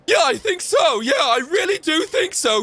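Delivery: hammed-up parody yelling agreement; mock leftist yelling. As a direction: bellow an eager yes in a shrill parody. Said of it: "they'd be like".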